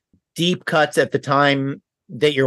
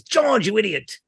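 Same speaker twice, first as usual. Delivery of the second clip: put-on higher, posh old film star voice; Ronald Coleman voice; 8 semitones higher than usual. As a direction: Ronald Coleman's exasperated scold. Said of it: "his catchphrase is".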